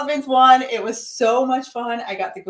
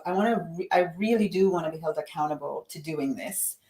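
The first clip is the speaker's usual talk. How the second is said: whispered, much softer inner voice; 2 semitones lower, 6 dB quieter, faster than usual. Retold or imitated. Voicing a viewer's inner wish to commit, whispered. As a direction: breathe it like a private promise.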